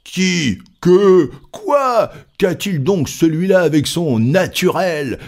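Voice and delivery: high, punched exclamations; incredulous crowd calling out from all sides; crowd outcry. A narrator's reading. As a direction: fire off each question high and loud. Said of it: "s'écria-t-on de toutes parts".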